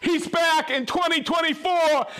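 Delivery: high, excited cry of recognition; overjoyed welcome back; thrilled greeting. Real, not acted. Regular viewer returns, host thrilled.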